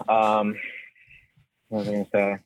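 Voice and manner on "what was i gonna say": losing the thought mid-sentence; trailing off, mind gone blank. Real, not acted. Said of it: "I'm completely blanking right now".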